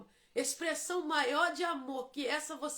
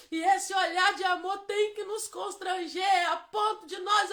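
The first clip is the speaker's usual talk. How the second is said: louder, higher, impassioned preaching climbing to a peak; fiery preaching; 4 semitones higher, 8 dB louder than usual. Acted. Pastor stirring the congregation about grace, louder and impassioned. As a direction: preach it with full fire, almost singing. Preaching builds to full force.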